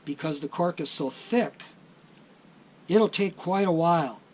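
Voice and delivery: ordinary talk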